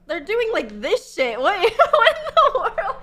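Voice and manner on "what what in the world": high, loud laughing what; can barely get the words out laughing; laughing disbelief. Real, not acted. Streamer reacting to a dance video, laughing loudly.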